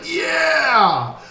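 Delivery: full-throated high whoop; theater yell. Retold or imitated. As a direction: cheer at the screen like you can't help it. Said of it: "I yelled so hard in the theater".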